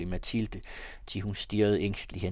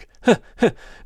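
high, loud delirious laugh; wild laughing outburst; mad laugh; 14 semitones higher, 15 dB louder than usual. A narrator's reading. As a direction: burst out in crazed laughter. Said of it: "vanvidets sorte sørgeflor".

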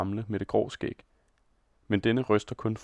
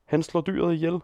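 higher, alarmed cry from the crowd; crowd scream; 6 semitones higher than usual. A narrator's reading. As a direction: shout it out in horror. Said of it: "skriger man rundt om".

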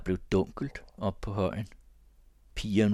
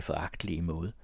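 ordinary delivery, nothing marked

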